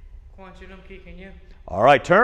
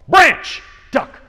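high, loud game call; called-out command; 14 semitones higher, 8 dB louder than usual. Real, not acted. Coach calling out jungle game cues to kids, loud high shout. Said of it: "I'm gonna call out".

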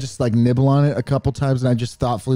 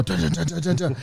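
normal talking voice

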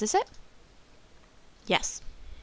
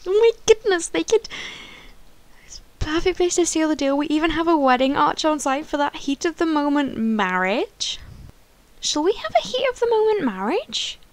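higher, louder, bursting with excitement; giddy excitement; 7 semitones higher, 5 dB louder than usual. Real, not acted. Gamer thrilled by the wedding arch, squealing excitedly. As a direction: gush over the wedding idea.

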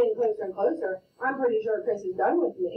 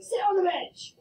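mock coach order; 10 semitones higher than usual. Coach orders player off court.

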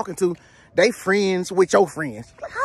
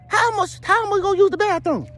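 squeaky high disbelief; high incredulous voice; 12 semitones higher than usual. Acted. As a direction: shoot the pitch way up in shock. Same shocked character.

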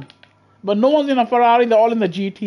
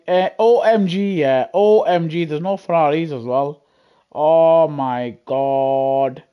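ordinary delivery

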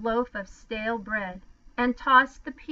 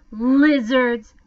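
scornful; 2 semitones higher, 8 dB louder than usual. A narrator's reading. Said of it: "said scornfully".

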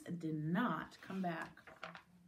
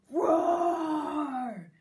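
big drawn-out roar, raised pitch; loud roar; 6 semitones higher, 11 dB louder than usual. Acted. Boy roaring at a baby rabbit, loud roar. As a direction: roar like a lion at full volume.

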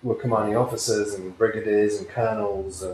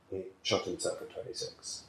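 nearly whispered, much softer; hushed; 11 dB quieter than usual. Real, not acted. Telling of his best friend's suicide, nearly whispered. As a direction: drop to a breath on the hard fact.

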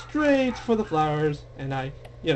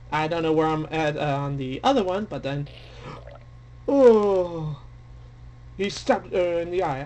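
normal talking voice

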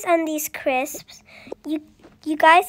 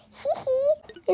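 soft high giggle; 10 semitones higher, 4 dB quieter than usual. Pretend. Witch giggle at story end.

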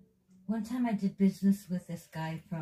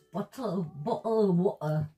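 mock British accent, louder; 3 semitones higher, 4 dB louder than usual. Teasing the other streamer's English, imitated British accent. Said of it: "Now he's got a British accent".

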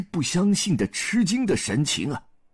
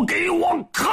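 battle shout; 13 semitones higher than usual. A narrator's reading. Zhu Bajie blocks the heavy staff, high battle shout. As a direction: heave it out as a high yell. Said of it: "嘴里大喊了一声".

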